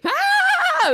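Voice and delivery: sudden high shriek of fright, half laughing; a laughing imitation of a child's scared yelp; retold scared yelp. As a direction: yelp like a kid who just saw a spider. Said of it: "He was, like, so scared".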